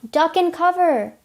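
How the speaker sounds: a restrained warning cry; narrated mild scream. A narrator's reading. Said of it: "someone screams".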